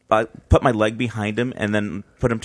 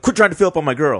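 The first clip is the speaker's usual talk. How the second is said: angry skinhead; 7 semitones higher, 5 dB louder, faster than usual. Retold or imitated. Skinhead guy confronting them.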